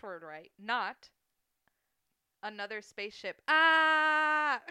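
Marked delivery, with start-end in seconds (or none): 3.5-4.6 loud high shriek of delight; excited high yelp. Real, not acted. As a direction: let out a sudden loud squeal. Punchline joke reaction.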